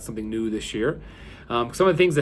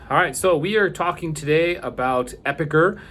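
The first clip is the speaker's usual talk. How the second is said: usual speaking voice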